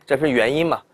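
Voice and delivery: ordinary delivery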